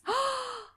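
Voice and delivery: high surprised gasp; delighted gasp. Real, not acted. Friend learning the band name, gasping in delight. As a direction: gasp with sudden glee.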